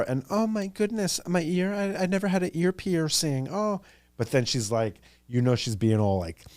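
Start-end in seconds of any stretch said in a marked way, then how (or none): 0.3-3.8 high, soft goody-goody voice; mock a dainty innocent girl, high and softly; prim and proper. Sandy fretting over an ear piercing, high prim mock voice. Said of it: "she acts all prim and proper".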